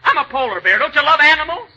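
normal talking voice

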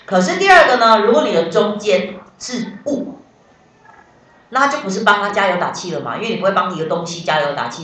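ordinary talk, nothing marked

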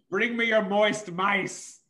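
hammed-up fussy creature voice; demanding hawk. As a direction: draw out a mock-regal demand as the hawk.

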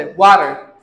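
वाटर as low "WAH-rer"; American "wah" sound. Shown as a demonstration. Teacher repeating American usage, वाटर as "WAH-rer". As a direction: open ah and tapped t in वाटर. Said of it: "वा दी sound आंदी".

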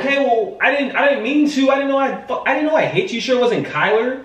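high-pitched, frantic denial; flustered protest. Real, not acted. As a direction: plead that it was an accident. Accused of hitting partner, protests.

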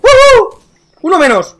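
high, loud victory whoop; excited cheer. Real, not acted. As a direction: cheer the kill. Kill in shooter game.

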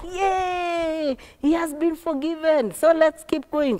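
high, drawn-out cheer; acted joyful cheering; cheering passengers. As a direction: celebrate like a happy trainload.

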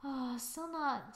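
normal talking voice

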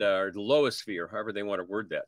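usual speaking voice

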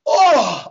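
loud, high imitated sigh dropping away; mock sigh of huge relief; big sigh of relief. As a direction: let it out like after a good workout. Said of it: "let out a big sigh of relief".

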